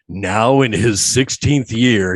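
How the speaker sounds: slow, booming movie-trailer announcer; lightly hammed-up trailer narration. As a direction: read it like an epic film promo. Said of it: "I'm not gonna read this like Don LaFontaine".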